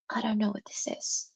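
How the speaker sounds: hushed, unvoiced whisper; a puzzled whisper to the chat. Real, not acted. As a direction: breathe it as a baffled aside to yourself. Puzzled aside then repeated aloud.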